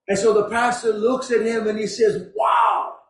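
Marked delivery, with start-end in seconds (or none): 2.4-3.0 high-pitched acted amazement; big, impressed "wow". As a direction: exclaim it, much higher, as if impressed. Pastor acts impressed by student.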